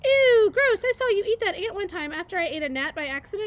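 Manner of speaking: high, loud squeal then fast babble; disgusted chattering squirrel. Acted. Chit Chat teases Bright Bill about eating an ant, squealing chatter.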